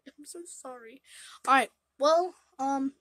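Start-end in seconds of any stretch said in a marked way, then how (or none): 0.1-1.0 breathy whispered apology. Real, not acted. Whispering sorry to the cat, whispered. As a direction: hush it out, barely voiced.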